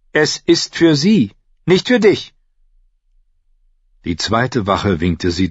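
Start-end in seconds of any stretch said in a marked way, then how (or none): 0.1-2.3 sharp cut-off. Acted. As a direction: cut the guard off firmly. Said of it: "schnitt ihm das Wort ab".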